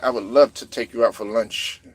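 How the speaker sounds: low, lightly put-on French accent; mock a suave French accent for a lunch invitation; Wembanyama's French accent. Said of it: "that French accent".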